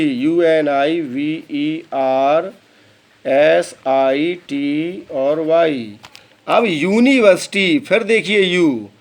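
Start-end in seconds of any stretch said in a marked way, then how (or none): 0.0-6.0 letters of university said very slowly; slow spelling. Shown as a demonstration. Teacher slowly spells university for students. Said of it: "इसको कहते हैं university".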